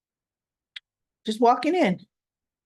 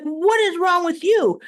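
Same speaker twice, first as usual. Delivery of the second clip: hurt and baffled, pitched up; stunned outrage; 8 semitones higher than usual. Retold or imitated. Drew holding his face, stunned at Nina. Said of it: "he's looking at her like".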